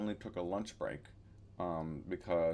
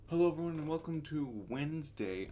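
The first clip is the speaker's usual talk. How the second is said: talking around a retainer; wearing a retainer; 8 semitones higher than usual. Real, not acted. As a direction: speak with a retainer in. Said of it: "I have my retainers in".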